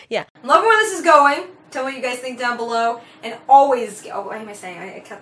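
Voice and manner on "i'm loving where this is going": fast, loud hype read; excited outro. Acted. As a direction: pump up the sign-off.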